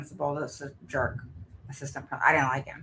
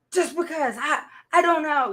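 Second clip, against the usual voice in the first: put-on higher, hesitant young daughter; daughter's frustrated answer; 11 semitones higher, 3 dB louder than usual. Mother voices her daughter stuck on why, higher and halting.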